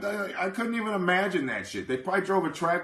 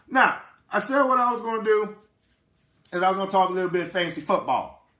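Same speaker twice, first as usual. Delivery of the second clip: raised-pitch, put-on coach voice; put-on voice, higher and a little forceful; Chan Gailey impression; 4 semitones higher, 4 dB louder than usual. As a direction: channel a football coach, pitched up and pushed. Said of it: "channeled my Chan Gailey voice".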